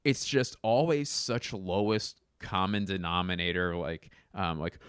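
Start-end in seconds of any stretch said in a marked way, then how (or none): none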